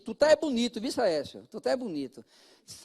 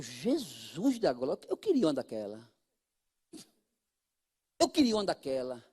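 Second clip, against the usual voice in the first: longing wish; 11 semitones lower, 7 dB quieter than usual. Retold or imitated. Pastor retells his own envy.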